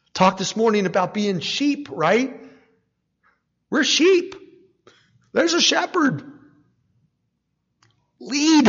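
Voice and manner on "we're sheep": ordinary talk, nothing marked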